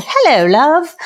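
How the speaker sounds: bad British accent. Greeting love each morning, in a corny British accent. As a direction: chirp a cheesy British "Hello, love". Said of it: "bad British accent comes in".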